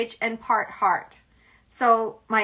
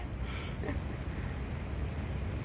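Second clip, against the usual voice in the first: laugh; 14 dB quieter than usual. Real, not acted. Laughing after the sassy line.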